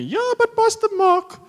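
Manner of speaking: very high, loud, drawn-out mock auntie voice; objecting auntie. Auntie objecting to the pastor, high mock voice. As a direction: squeal it like a protesting church auntie. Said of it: "It's, it's Auntie Sarah".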